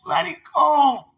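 a tearful, emphatic voice for God; relaying God's words. Retold or imitated. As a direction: pass on God's command, drawing it out. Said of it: "God said".

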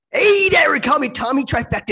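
loud, high persona intro; hyped intro. Acted. Co-host introducing his alter ego to viewers, loud and hyped. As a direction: announce yourself like a showman.

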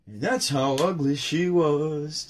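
slow, processed sting; a dragged-out echo catchphrase; slowed meme voice; slowed-down tagline. Pretend.

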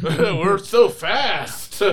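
very slow mock. Fake chariot racers.

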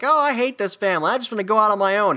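put-on high, fast bratty kid voice; sulky kid griping about his family; whiny kid. Kevin whining to himself about his family, bratty and high. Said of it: "Kevin McAllister's like".

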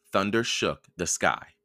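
ordinary delivery, nothing marked

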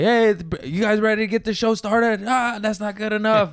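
high, hyped-up mock announcer voice; voice of God announcer. Mimicking the manager's hype intro to the crowd, mock announcer. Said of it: "So doing voice of God".

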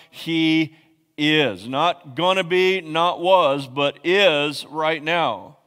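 hammering home the present tense; preaching emphasis. Acted. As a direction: lean on every is.

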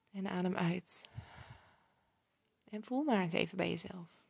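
calm breathing guide, hushed and unhurried. Acted. Leads a breathing exercise.

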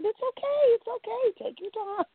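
a light Betty impression, tender and reassuring; soothing reassurance. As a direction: soothe her like a kind grandmother. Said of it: "She said".

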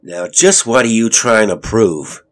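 heated, forceful demand; angry. A narrator's reading. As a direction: challenge your wife angrily. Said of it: "He was angry at her".

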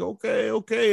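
put-on high Vietnamese-accented okay. Sergeant major looking Lopez over, Vietnamese accent.

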